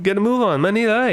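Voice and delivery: high, brisk hurry-up call; urging to hurry. Shown as a demonstration. Teacher demos urging friends to hurry up. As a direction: hurry someone out the door. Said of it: "Or if I say, like".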